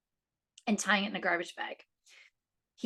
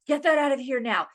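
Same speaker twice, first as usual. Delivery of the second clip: loud, higher angry demand; wife yelling at husband to remove the smelly bag; retold angry yell; 7 semitones higher, 7 dB louder than usual. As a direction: snap it at him like an upset mom. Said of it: "I'm getting, like, so angry".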